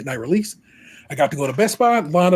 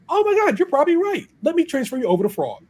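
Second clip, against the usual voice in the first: much higher, squeaky sudden realization; mock surprised supervisor; 13 semitones higher, faster than usual. Supervisor Mandy realizing the card is on fraud hold, high mock surprise. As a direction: play the supervisor catching on all at once.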